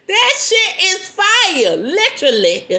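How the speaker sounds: high, loud and drawn out, breaking into laughter; real amused exclaiming. Real, not acted. Host reacts to cinnamon oil burn story, laughing loudly. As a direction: exclaim it through rising laughter.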